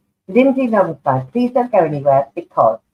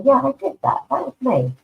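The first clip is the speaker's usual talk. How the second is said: higher, cocky and proud; 8 semitones higher than usual. Pretend. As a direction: own it, a little swagger. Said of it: "allowed to be cocky".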